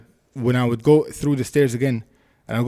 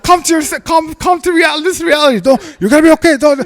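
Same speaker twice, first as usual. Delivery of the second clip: high, loud mock urgency; insistent, raised-pitch orders, acted out; retold urgent pep talk; 12 semitones higher, 6 dB louder than usual. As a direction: re-enact shaking sense into a panicked man. Retold talking down panicked man.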